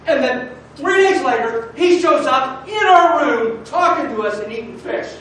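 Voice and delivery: loud, high, urgent testimony; fervent disciples' witness. Acted. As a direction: proclaim it like an eager eyewitness. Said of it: "what they probably sounded like? Like I do right now".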